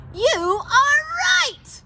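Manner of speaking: high, loud, slow cheer; excited Pete. Acted. Pete thanking the owl, high and thrilled. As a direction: burst out with joy.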